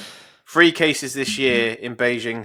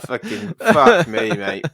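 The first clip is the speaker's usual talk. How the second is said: high, loud, drawn-out Irish-tinged South African; exasperated mock South African, sliding into Northern Irish; botched South African accent; 10 semitones higher, 6 dB louder, much slower than usual. Quoting the exasperated badger owner, botched South African accent. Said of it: "Northern Irish accent for a South African guy".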